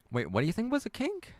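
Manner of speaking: very high, astonished question; incredulous squeaky question; startled disbelief. Real, not acted. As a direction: shoot the pitch up, taken aback. Taken aback by viewer's misreading.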